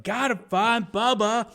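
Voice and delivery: high, slow urgent Forrest; Forrest Gump voice. Retold or imitated. Fan quoting Forrest running back into the jungle, high slow mock urgency. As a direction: stretch it out, desperate but hammed.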